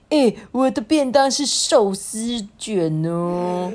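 slow, drawn-out character boast; mildly excited little classmate in a story. Acted. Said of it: "茄子啊就很兴奋说".

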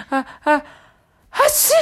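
high "ah, ah, ah" building to a sneeze; acted sneeze. A narrator's reading. Storyteller acting out the pig's trumpeting sneeze, mock sneeze. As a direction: wind up slowly, then burst into a sneeze. Narrator acts out a big sneeze.

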